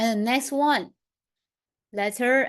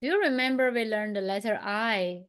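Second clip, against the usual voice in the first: ordinary delivery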